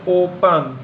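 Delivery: ओपन with the O drawn out slowly; teacher stretching the vowel in ओपन; long O sound. Shown as a demonstration. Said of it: "उसका जो होगा उच्चारण, वो दीर्घ उच्चारण होगा".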